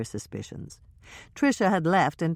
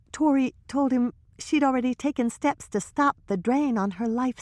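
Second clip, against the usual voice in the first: put-on higher young woman's voice, hushed gossip; Trisha's voice; 10 semitones higher than usual. Trisha confiding to Hannah and Michelle, higher nervous voice.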